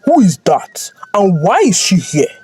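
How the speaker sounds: demanding. A narrator's reading. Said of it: "demanding an explanation".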